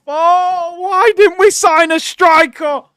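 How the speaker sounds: high, loud cry of regret; exasperated wail. Real, not acted. Arsenal fan watching rivals crumble, exasperated wail. Fan lamenting own club's transfers.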